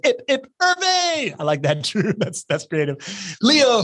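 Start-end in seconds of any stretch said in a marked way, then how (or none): 0.0-1.3 high, drawn-out hip-hip-hooray cheer; mock cheer. Host reading out a viewer's roll-call cheer, high drawn-out hooray. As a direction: sing out a cheer, stretching the last word high.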